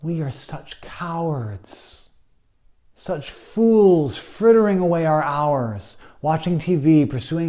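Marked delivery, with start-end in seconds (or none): none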